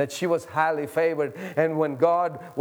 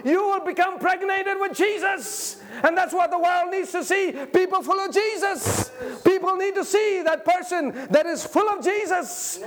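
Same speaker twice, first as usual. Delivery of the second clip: preaching at full force; 10 semitones higher than usual. Acted. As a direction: whip the crowd up, shouting Jesus.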